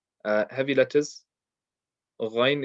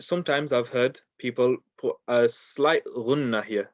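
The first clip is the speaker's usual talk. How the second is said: ordinary talk, nothing marked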